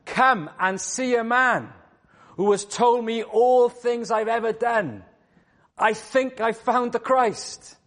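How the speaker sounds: high, slow excited calling out; crying out. Acted. Woman running back to town, excitedly calling out to everyone. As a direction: call the town to come and see. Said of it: "she's crying out".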